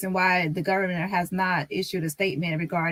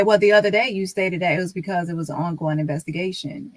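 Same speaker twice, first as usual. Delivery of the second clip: ordinary delivery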